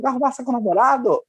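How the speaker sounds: very high-pitched mock shriek of a mother; mãe gritando. (imitando a mãe gritando, bem agudo)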